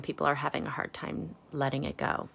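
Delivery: plain reading voice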